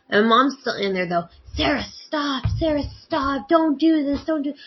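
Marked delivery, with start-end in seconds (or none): 1.5-4.5 higher, pleading imitation of Mom crying out; mock victim plea. Mom begs Sarah to stop, imitated pleading victim. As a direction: ham up Mom playing the victim. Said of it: "trying to play the victim".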